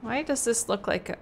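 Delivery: ordinary talk, nothing marked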